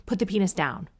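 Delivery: ordinary talk, nothing marked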